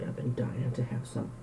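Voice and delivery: soft unvoiced whisper. Real, not acted. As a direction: breathe it like a secret craving. Whispers while eating.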